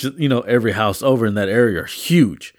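ordinary talk, nothing marked

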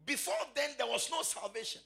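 high, ringing, half-sung preaching; preaching at full force. Acted. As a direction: preach it out to the congregation.

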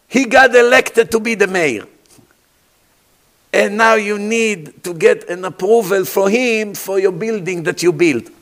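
ordinary delivery

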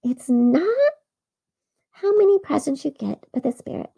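a hint of the angel's high voice; lightly echoing the angel; remembered angel. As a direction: Taylor recalling the angel. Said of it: "remember what the angel said".

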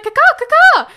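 high, loud squawking eagle call; hammed-up bird caw; eagle cry imitation. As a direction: screech like a bald eagle.

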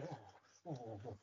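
very soft "ooh" puffs of pretend cold; hushed shivery oohs, as if freezing; mock shivering. Actor showing how he faked winter, soft shivering. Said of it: "making it look like winter".

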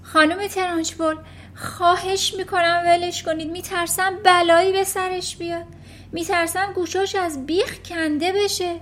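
high, anxious pleading; begging. Acted. Miss Honey begging the headmistress to release the boy. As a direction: beg her to let the boy go. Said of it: "خانم هانی التماس کرد".

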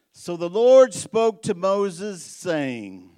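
loud, slow, high preaching read; scripture reading. Acted. Pastor reads Numbers to the church, proclaiming loudly. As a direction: proclaim the Lord's words slowly and loudly.

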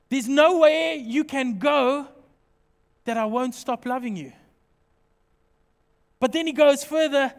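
ordinary talk, nothing marked

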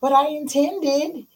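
high whiny protest; whiny excuse. Pretend. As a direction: plead the excuse high and slow. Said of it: "You can intend a lot".

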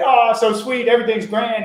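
high, loud gushing mock cheer; hammed-up sugary voice of a delighted mom; mock sweet delight. Mock of hoped-for sweet reaction.

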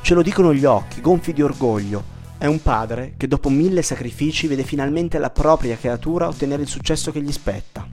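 ordinary delivery

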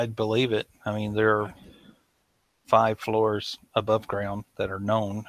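ordinary delivery

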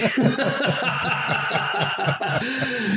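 high, rolling laugh; sustained amused laughter; real laugh. Real, not acted. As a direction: laugh long at your own story. Laughs at his own anecdote.

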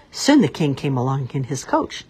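ordinary delivery

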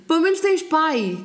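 high, drawn-out mock consolation; imitated condoler. People phoning the bereaved, mock consoling. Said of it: "diziam-me assim".

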